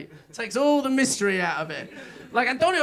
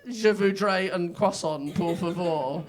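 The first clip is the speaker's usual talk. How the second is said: put-on slow, lower, clumsy English-tourist French accent; mock French accent; 5 semitones lower, slower than usual. Comic Englishman mocking his own bad French accent. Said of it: "So we're all like".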